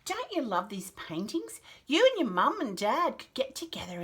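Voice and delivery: ordinary delivery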